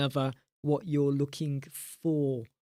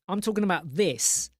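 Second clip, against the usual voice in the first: ordinary talk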